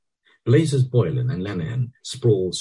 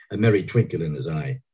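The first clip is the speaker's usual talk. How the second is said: ordinary delivery, nothing marked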